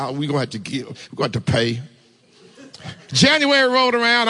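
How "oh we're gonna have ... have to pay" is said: lower, much softer mock grumble; imitating grumbling. Husband grumbling to his wife about taxes, low mock grumble. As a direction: mutter it like a sulky husband. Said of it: "I grumbled".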